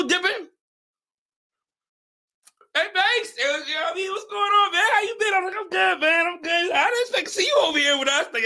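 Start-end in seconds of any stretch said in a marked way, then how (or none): 2.7-8.3 lifted, higher-pitched chatty hello; acted friendly greeting. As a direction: play both sides of an upbeat greeting. Acted greeting between acquaintances.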